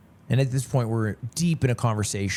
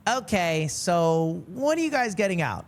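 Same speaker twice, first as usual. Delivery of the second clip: imitating girlfriend; 6 semitones higher than usual. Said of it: "And she goes".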